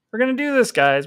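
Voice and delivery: rally yourself like a coach; pep talk. Acted.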